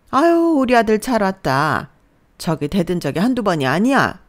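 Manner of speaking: mother-in-law gloating as her son arrives. Acted. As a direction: welcome the son, then tattle. Mother-in-law greets son, tattles.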